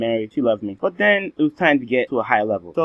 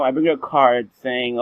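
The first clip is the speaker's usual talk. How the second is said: ordinary delivery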